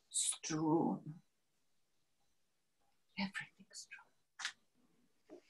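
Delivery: very soft, drawn-out near-whisper; desolate hush. Acted. Woman finding the scattered diary papers, desolate hush. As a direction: fade into a slow whisper.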